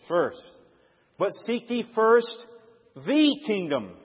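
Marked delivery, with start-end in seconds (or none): 1.2-4.0 "the" said as a long, raised "thee" after a pause; pause, then stretch "the" into "thee"; stress on the. Shown as a demonstration. Preacher singling out one kingdom among many, stressing "the". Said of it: "The is an important word".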